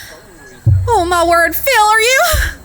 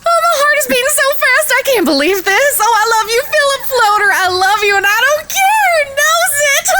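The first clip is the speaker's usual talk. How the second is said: breathless joy; 7 semitones higher than usual. Acted. Overjoyed after proposal.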